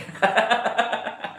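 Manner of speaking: high staccato laugh. Real, not acted. Speaker joking to viewers about their sweet smile, laughing. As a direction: burst out in a high, rapid chuckle.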